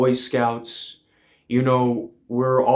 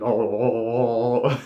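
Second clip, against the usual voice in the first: long wavering mock moan; drawn-out "oh". Comic acting out wife drinking coffee, long moaned oh. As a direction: draw out a moan that ends in a laugh.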